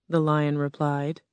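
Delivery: plain reading voice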